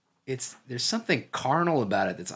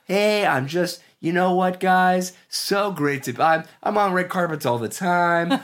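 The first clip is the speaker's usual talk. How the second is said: higher, louder, forced nonchalant cool; fake casual; 8 semitones higher, 4 dB louder than usual. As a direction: act breezy like it's nothing. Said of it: "you've gotta act like".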